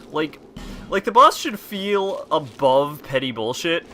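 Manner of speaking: ordinary talk, nothing marked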